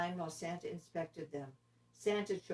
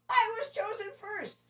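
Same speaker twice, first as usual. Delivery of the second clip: much higher, indignant squeal; angry reindeer; 5 dB louder than usual. Acted. Dasher fights Dancer over lead spot, high and angry. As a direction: stamp and insist. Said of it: "stamping angrily".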